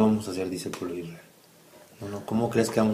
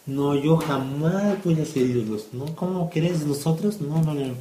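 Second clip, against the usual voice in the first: higher, slow, indignant no's; scoffing boast; 6 semitones higher, 3 dB louder, slower than usual. Acted. Israel insists they will never make idols, scoffing. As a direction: scoff at the very idea.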